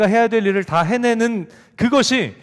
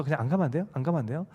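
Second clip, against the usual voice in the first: softer whiny begging; pleading softly; 3 semitones lower, 7 dB quieter, faster than usual. Retold or imitated. Child begging mom again, soft whine. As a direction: wheedle quietly like a child. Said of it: "조르기 시작한 거예요".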